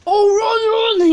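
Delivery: imitating a kid's high, loud panicked cry; mock panic. Boy fleeing the shop owner, imitated high panicky yelp. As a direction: yelp it like a scared kid bolting.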